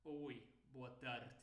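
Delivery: low, slow imitation of European Portuguese; European accent. Shown as a demonstration. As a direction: mimic a Portugal accent, swallow tarde's ending. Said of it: "um europeu vai falar".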